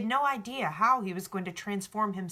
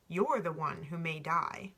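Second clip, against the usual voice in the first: slow line dissolving into a laugh. Acted. Alchemist laughs at the boy, slow and amused. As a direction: tease him and laugh.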